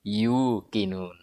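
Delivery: Yukinun drawn out slowly and low; slow answer form. Shown as a demonstration. Teacher slowly answers with Yukinun. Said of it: "sehingga jadi bunyinya apa?".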